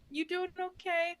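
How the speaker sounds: soft, high, hushed check-in; gentle concern. Retold or imitated. Hero softly asking tired Kate if she is okay. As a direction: ask it gently, almost tenderly. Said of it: "and he's like".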